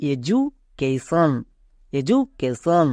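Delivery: এডুকেশন drawn out very slowly with a j, e-ju-ke-shon; very slowly model এডুকেশন with a j; correct education. Shown as a demonstration. Teacher modelling for students, very slow clear pronunciation. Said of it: "উচ্চারণটা কি দাঁড়াবে".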